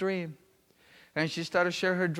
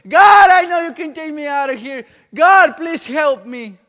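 loud, high desperate cry to God; very loud retold plea, slowing on the last call; 8 semitones higher, 14 dB louder than usual. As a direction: cry out for rescue, imitating her plea. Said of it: "she started crying and said".

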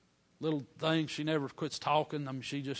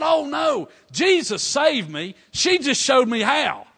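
put-on excited child; 9 semitones higher, 11 dB louder, slower than usual. Child excitedly corrects the preacher.

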